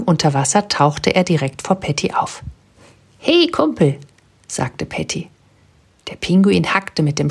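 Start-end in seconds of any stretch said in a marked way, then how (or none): 3.2-4.0 bright greeting. Acted. Patty greets the penguin.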